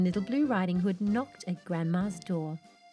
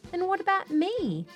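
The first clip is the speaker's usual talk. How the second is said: very high, plaintive question; left-out protest; 12 semitones higher than usual. Acted. Wolf, left out of the presents, protesting. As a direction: butt in, pitched right up, wanting a share.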